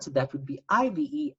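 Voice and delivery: ordinary talk, nothing marked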